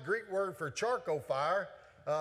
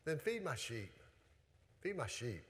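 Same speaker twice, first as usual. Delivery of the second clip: Jesus speaking low and much softer, tenderly; 5 semitones lower, 8 dB quieter than usual. Acted. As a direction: gently hand Peter the task, softer each time. Voicing Jesus to Peter.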